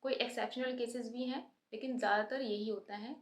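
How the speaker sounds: ordinary talk, nothing marked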